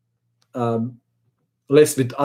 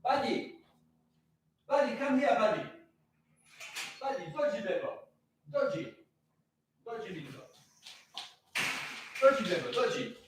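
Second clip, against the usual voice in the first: distant calls to the dog, high and faint; calling out from far off, half out of earshot; 8 semitones higher, 15 dB quieter, faster than usual. Real, not acted. Owner calling his dog in from outside, far off and high.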